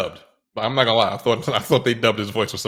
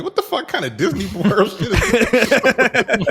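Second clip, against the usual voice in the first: high, slow, laughing mock outrage; incredulous laughing quote; laughing disbelief; 13 semitones higher, 5 dB louder, slower than usual. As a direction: laugh through the disbelief, drawn out. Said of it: "I'm like".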